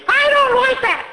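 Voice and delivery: put-on high little kid's voice, objecting; a fussy child complaining. Child to the storyteller, high kid voice objecting.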